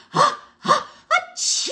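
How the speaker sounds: high, loud build-up into a sneeze; sneezing again. A narrator's reading. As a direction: gasp upward, then explode in a mock sneeze. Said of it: "I sneezed again".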